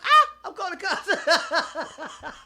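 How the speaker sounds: mock tattletale. Mock argument, calling cops.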